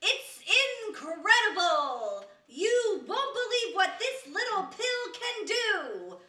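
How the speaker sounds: forced, loud barker enthusiasm, slowed; sales pitch. Acted. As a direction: hawk the pill like a carnival barker. Apprentice tries a sales pitch.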